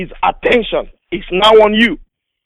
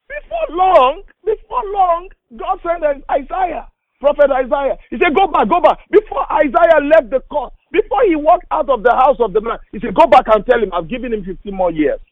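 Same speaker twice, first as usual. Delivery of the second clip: excited preaching; 7 semitones higher than usual. Acted. High-pitched excited storytelling.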